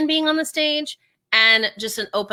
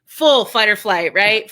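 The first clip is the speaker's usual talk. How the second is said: usual speaking voice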